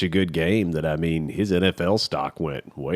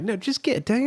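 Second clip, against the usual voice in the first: football-coach yelling. Retold or imitated. Football coach in a fan yelling at the runner, mock yell. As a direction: imitate a coach pleading from afar. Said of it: "like, yelling".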